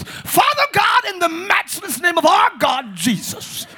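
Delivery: high, slow, theatrical prayer cry; mock a show-off praying for the crowd; hammed-up booming public prayer; big prayer spectacle. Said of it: "don't make these big prayer spectacles".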